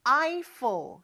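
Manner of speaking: "Eiffel" with an English "AI" vowel, stress on the first syllable; English pronunciation. Shown as a demonstration. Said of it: "이렇게 발음을 해요". ("Eiffel"을 첫 음절 강세로 아이펠)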